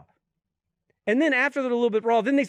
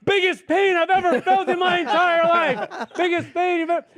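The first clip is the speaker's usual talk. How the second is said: laughing disbelief; 8 semitones higher, 4 dB louder than usual. Real, not acted. Comedian telling friends about the worst pain, laughing. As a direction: laugh through the memory of the pain. Recalling painful procedure, laughing.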